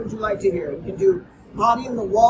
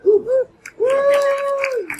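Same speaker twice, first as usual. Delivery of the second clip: long high hammed-up woo; theatrical high howling finish; howling bell cry; 8 dB louder, much slower than usual. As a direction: howl it like a ghost bell. Bell finale howl.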